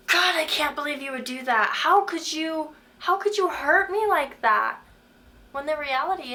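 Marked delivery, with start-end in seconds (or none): 0.1-4.8 higher, louder, slowing on how could you; mock hurt outburst at God. Speaker imitating her own hurt outburst at God, louder and higher. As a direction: act out the wounded cry at God. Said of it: "you're just like".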